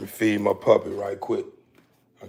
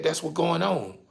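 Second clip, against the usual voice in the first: ordinary talk, nothing marked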